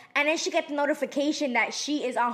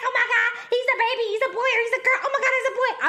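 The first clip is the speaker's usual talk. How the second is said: high-pitched excited squealing retelling; giddy, high and breathless gushing; going crazy; 9 semitones higher, faster than usual. Retold or imitated. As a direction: reenact freaking out over baby news. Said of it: "I'm starting going crazy".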